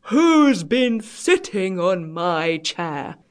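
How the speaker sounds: very slow, drawn-out big bear; great big bear voice. A narrator's reading. Great big bear finds chair used, in a great big voice. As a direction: boom it out slowly as a huge bear. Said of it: "in his great big voice".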